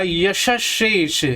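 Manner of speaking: यशशेष drawn out very slowly, each syllable stretched; drawn-out word. Shown as a demonstration. Teacher spelling out यशशेष slowly for students. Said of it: "तीन तीन बार यशशेष".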